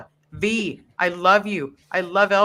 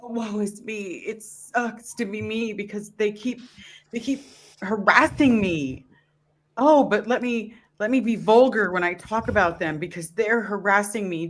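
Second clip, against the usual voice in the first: higher-pitched mock self-pity; mock victim; 6 semitones higher than usual. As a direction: mimic him playing the poor victim. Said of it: "say that he's the victim".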